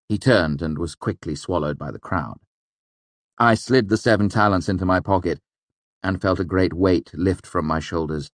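usual speaking voice

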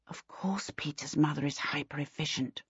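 whispered private thought; hushed whisper; inner thought. A narrator's reading. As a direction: drop to a whisper, thinking to yourself. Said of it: "she thought".

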